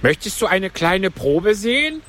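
high, plaintive turtle voice; Mock Turtle. A narrator's reading. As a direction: pitch it up for the tearful turtle. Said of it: "fragte die falsche Schildkröte". (hohe Stimme der falschen Schildkröte)

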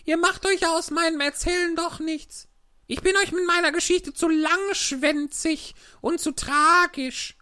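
high, squeaky mouse voice, sulking; a small hurt mouse complaining; offended little mouse. A narrator's reading. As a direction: mouse grumbling that nobody listens to her tale.

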